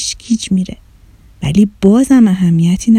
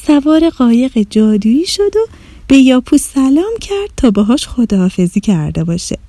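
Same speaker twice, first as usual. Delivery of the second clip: ordinary delivery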